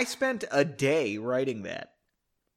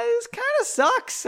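much higher, slow whiny voice; mock disappointed self; slower than usual. Writer judging own chapter, high whiny mock. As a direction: whine it like a let-down writer.

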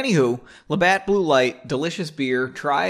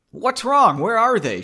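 high, loud startled questions; frantic; 9 semitones higher, 5 dB louder than usual. A narrator's reading. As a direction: call out as if waking in alarm. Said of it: "looking around the room frantically".

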